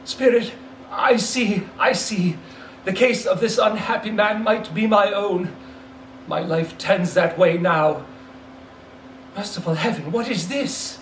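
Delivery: a hint of dawning dread in Scrooge; Scrooge, horrified. Scrooge realising the dead man is himself, horrified.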